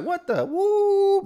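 very high, slow squeal of disbelief; exasperated outburst. Retold or imitated. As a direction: stretch it out in annoyed disbelief. Annoyed Steve at dawn call.

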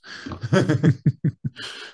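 laugh. Real, not acted. Laughing after the story.

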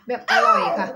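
high, loud review gush; excited. Pretend. Mock reviewer exclamation.

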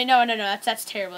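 ordinary talk, nothing marked

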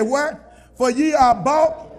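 preaching scripture. Acted. Reads scripture.

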